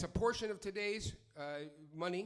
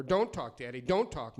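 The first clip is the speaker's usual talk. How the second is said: louder, telling the father to shut up; dinner table clapback, louder; mock outburst; 6 semitones lower, 7 dB louder than usual. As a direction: cut Daddy off hard. Poem's narrator snaps at parents.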